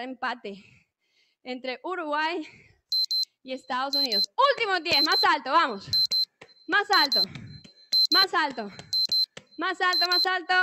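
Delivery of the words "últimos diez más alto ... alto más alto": loud, driving calls to push harder; coach shouting. Real, not acted. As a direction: bark it out to push the class through the last reps. Coach urging class, final reps.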